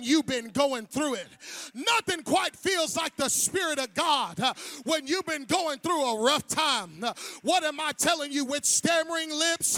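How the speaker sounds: loud, high, sing-song preaching; full-force preaching. Acted. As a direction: preach it out to the whole church.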